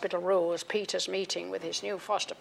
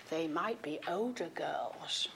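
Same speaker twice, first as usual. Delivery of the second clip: hushed aside; 3 semitones lower, 7 dB quieter, slower than usual. Retold or imitated. Older boy's hopeful aside.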